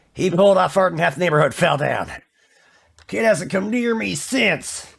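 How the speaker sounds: Walter impression. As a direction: tell it like a cranky grandpa. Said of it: "they're just like".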